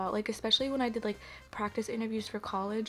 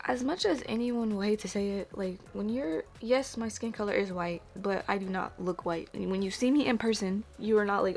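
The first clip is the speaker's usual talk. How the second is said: ordinary talk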